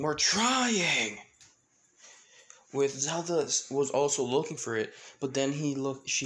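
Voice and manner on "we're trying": loud, high strained yell; exasperated shout. Acted. Everyone answering Elijah while searching, exasperated shout. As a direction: yell back, stretching the word out. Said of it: "all of them saying".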